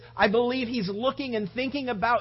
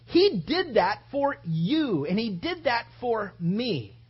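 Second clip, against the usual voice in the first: ordinary talk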